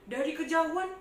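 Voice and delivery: ordinary delivery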